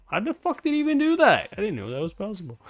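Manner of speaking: normal talking voice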